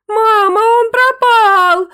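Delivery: high, loud sobbing wail, drawn out slowly. Acted. Angelinka sobbing to her mother that the dog vanished. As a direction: sob out the loss. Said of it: "зарыдала Ангелинка".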